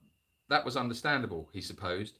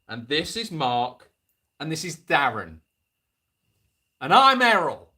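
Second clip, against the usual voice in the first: put-on odd northern kid voice; 7 dB louder than usual. Tallest boy introduces his friends in an odd northern accent. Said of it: "they speak like that from this particular village".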